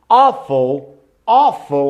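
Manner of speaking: Awful with a closed O, said slowly; teacher models the closed O in Awful; closed O sound. Shown as a demonstration. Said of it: "um som de O fechado".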